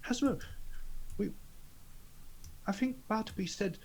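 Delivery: high, soft, halting voice; character speaking up hesitantly. Acted. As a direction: speak up timidly with pauses. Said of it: "and he's gonna say".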